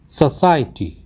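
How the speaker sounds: सोसाइटी said as "suh-SAI-tee"; correct pronunciation. Shown as a demonstration. Teacher gives learners the correct word, carefully pronounced. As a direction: say सोसाइटी with a weak first vowel. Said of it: "इसका सही उच्चारण होता है".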